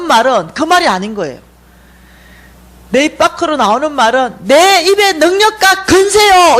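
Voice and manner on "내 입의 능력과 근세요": high, loud, slow fervent plea; fervent cry. Retold or imitated. Dreamer's mouth cries a prayer she never meant, fervently. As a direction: proclaim it slowly and loudly. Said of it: "이렇게 나오는 거예요".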